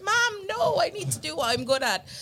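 high pleading daughter; pleading teen. Retold or imitated. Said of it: "And I remember, like".

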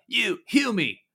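high, loud gruff dwarf yelling at a stranger; angry dwarf shout. Acted. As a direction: bark it like an outraged mountain dwarf. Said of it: "one of them says".

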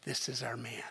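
crying out, turned to a whisper; hushed crowd cry. Retold or imitated. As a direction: breathe it like a secret hope. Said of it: "they're crying out".

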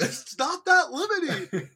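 high, loud mock voice. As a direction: play it up. Mock quote.

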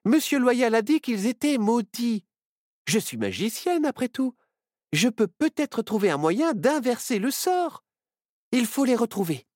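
very high-pitched, eager owl character; high, animated little voice; excited cry. A narrator's reading. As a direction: blurt out a hopeful plan. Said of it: "s'écria Myrtille".

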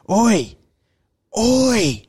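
high, drawn-out mock holler; calling out. Crackhead calling after two mates leaving the shop, high mock holler. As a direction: imitate an aggressive stranger hailing someone. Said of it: "by the third or fourth call".

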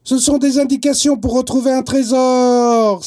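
high, loud joyful cry; excited exclaim. A narrator's reading. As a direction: shout out the discovery together. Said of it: "s'écrient les deux garçons en chœur". (cri d'enthousiasme, plus aigu et plus fort)

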